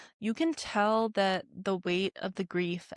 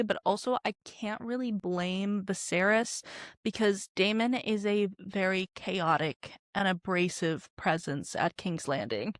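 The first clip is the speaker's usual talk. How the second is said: normal talking voice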